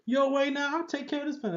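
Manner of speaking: a lightly voiced swaggering Shane; a bad, half-hearted tough-guy imitation; Shane impression. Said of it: "It was the worst Shane impression".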